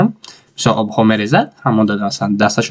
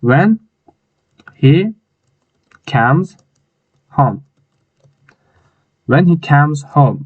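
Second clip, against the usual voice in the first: word by word dictation; 3 semitones higher, much slower than usual. Shown as a demonstration. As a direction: dictate one word at a time. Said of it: "dictating clause word by word". (کلمه به کلمه با مکث)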